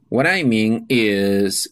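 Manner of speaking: slow, deliberate model phrase; slow teacher demo of "What I mean is". Shown as a demonstration. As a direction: lay out "What I mean is" slowly for learners. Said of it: "For clarifying the meaning is".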